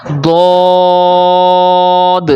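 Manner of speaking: دود stretched very long with a heavy d; drawn-out emphatic دود; thick Arabic d. Shown as a demonstration. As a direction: recite دود full and rounded on a steady note. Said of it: "موٹی آواز کے ساتھ پڑھنا ہے".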